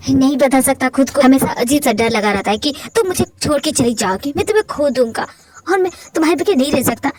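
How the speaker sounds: normal talking voice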